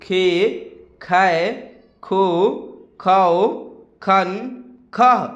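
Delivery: syllables one by one with long gaps; slow recitation. Shown as a demonstration. Teacher leading students to repeat along, slow recitation. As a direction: recite each sound separately, unhurried. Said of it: "धीरे धीरे उच्चारण करते चलिएगा".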